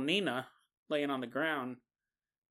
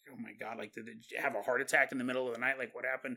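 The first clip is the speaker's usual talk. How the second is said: hushed shock; 6 semitones lower, 8 dB quieter, much faster than usual. Retold or imitated. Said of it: "and he's probably like".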